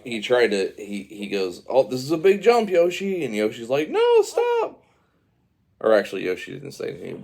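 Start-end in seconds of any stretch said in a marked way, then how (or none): 1.7-3.2 higher, louder cartoon heads-up; alarmed warning. Mario to Yoshi before a big jump, high urgent warning. 3.9-4.8 very high, loud cartoon squeal; mock panicked plea. Yoshi to Mario before the jump, high panicked plea.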